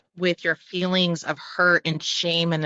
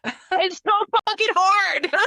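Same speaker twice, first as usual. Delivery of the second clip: slow, much higher, breaking into laughter; laughing exasperation; slower than usual. Real, not acted. Admitting to her co-host that it's hard, laughing.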